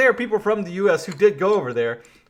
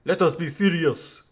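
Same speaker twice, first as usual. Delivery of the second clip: mock French accent. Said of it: "oh, sorry".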